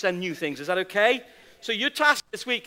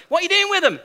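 pestering whiny nagging; 9 semitones higher, 4 dB louder, much faster than usual. Retold or imitated. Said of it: "She must have asked me five times".

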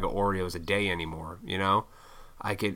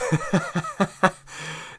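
breathy laugh; 9 semitones higher than usual. Real, not acted. Laughs after gym bro impression.

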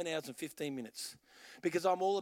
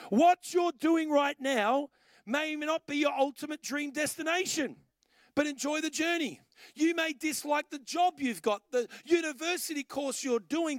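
louder, higher, pressing the point home; full-force preaching; 5 semitones higher, 5 dB louder than usual. Acted. Preacher urging the congregation to enjoy the journey, in full preaching voice. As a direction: preach it to the whole room.

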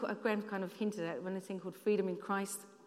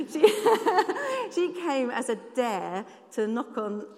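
laughing; 2 semitones higher, 6 dB louder than usual. Real, not acted. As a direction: laugh loudly, then carry it into the line. Laughs telling Sophie's story.

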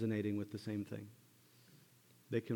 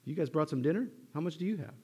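high, bright questioning as Jesus; high lively mock question; Jesus asking; 7 semitones higher, 4 dB louder than usual. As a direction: ask it playfully, a little teasing. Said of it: "And Jesus says".

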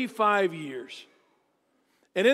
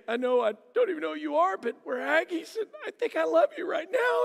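fast, climbing into a hammed-up sob; mock crying; 8 semitones higher than usual. [name] telling Brian he loves him, mock weeping. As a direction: gush it out through fake tears.

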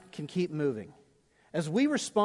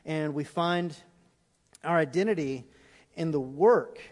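prayer; 4 semitones lower, 4 dB quieter than usual. Acted. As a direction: pray quietly as the band comes in. Closing prayer over music.

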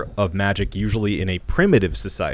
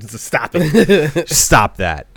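laughing protest; 5 semitones higher, 7 dB louder, much slower than usual. Real, not acted.

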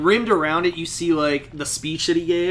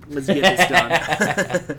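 laughing, slowed; much slower than usual. Retold or imitated. Tony's quote, breaking into laughter.

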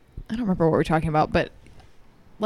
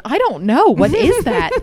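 mock innocent; 8 semitones higher, 6 dB louder than usual. Pretending not to know the noise.

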